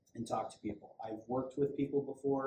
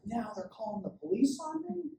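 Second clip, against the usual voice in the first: indignant person; 12 semitones higher, 3 dB louder than usual. Retold or imitated. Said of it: "they were like".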